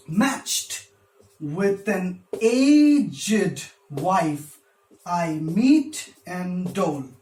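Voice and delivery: very slow, "matched" first, "aged" stretched on its first syllable; scanned metrical stress. Shown as a demonstration. Teacher scanning for students, slow exaggerated stress on "matched", "aged", "meet". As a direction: punch MATCHED, draw out A in "aged", hit "meet". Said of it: "The first one is not iambic, it is trochaic".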